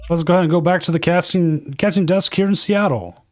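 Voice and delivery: high, fast sportscast hand-off; football announcer. Pretend. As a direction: toss it to the desk like TV football. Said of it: "trying to sound like a football announcer".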